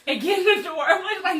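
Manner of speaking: ordinary delivery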